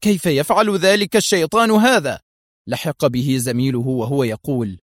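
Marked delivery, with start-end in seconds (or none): 0.0-2.2 higher, louder disbelieving cry; exasperated shout. Acted. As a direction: call out in frustration. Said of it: "فهتف".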